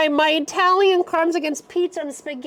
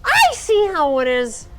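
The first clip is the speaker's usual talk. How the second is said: mock outrage; 8 semitones higher than usual. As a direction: declare war in mock fury.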